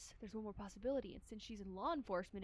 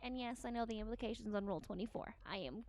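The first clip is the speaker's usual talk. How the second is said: imperious detective woman; 2 semitones higher than usual. Acted. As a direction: bark Servant like a haughty boss. Shigure voiced.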